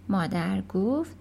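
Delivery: ordinary delivery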